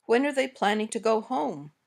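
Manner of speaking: "home" falls at the end of the question; falling intonation. Shown as a demonstration. Teacher gives learners a wh-question to repeat, falling intonation. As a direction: let the voice go down on "home". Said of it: "Try these examples".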